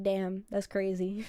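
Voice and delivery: low, slow, understated disbelief; stunned. Retold or imitated. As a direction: say it as if mildly dazed. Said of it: "sitting there stunned".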